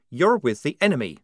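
a touch of a hissed accusation; voiced, lightly hissed reproach; mild hiss. A narrator's reading. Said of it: "hissed Polly".

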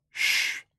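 soft unvoiced shh; whispered shush. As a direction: hush them softly. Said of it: "said Slarty Bartfast".

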